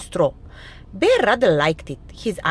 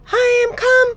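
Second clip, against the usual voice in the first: high, loud little mouse voice; cheerful mouse; 9 dB louder than usual. A narrator's reading. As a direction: pipe up merrily as a mouse. Cheerful Mouse arrives at sick Bear's.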